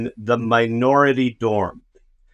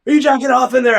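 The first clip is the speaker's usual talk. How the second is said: pressing dorm guys; 11 semitones higher, 4 dB louder, faster than usual. Retold or imitated. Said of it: "they're like".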